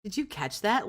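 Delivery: ordinary talk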